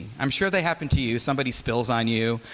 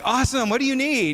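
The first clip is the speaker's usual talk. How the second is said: eager barista; 11 semitones higher than usual. Retold or imitated. Barista asks groggy customer her order, over-eager and high. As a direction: sell it like Mister Cool barista. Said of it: "And I'm like".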